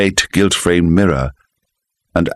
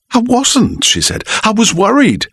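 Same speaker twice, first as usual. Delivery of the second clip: higher, defensive wife; worried wife. A narrator's reading. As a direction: protest you were only worried.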